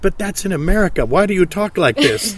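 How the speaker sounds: lightly voiced confused stranger; a hint of disbelief; mock puzzled outsider. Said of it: "it's like".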